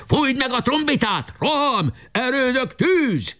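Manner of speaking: high, ringing battle cry; shouted commands. A narrator's reading. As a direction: bellow the orders to the troops. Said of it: "kiáltott".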